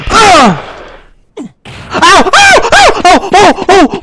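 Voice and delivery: rapid high-pitched yelps of shock; startled yelps. Real, not acted. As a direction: yelp as if hit by gunfire. Suddenly shot in the game.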